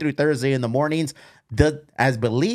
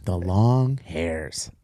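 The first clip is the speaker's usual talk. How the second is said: slow, much lower announcer echo of the name; slow dramatic echo; 7 semitones lower, much slower than usual. Acted. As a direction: draw the name out low and slow like a trailer voice. Host echoes the brand name dramatically.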